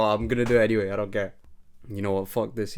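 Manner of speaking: ordinary talk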